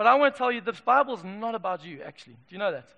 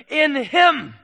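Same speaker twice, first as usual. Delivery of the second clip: triumphant; 4 semitones higher, 10 dB louder than usual. Acted. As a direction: ring it out loud and triumphant. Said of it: "it declares triumphantly".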